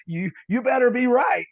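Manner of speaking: high, loud retold warning; mock warning. Pastor hearing of the prophecy, emphatic warning.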